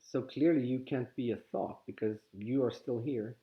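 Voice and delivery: ordinary talk, nothing marked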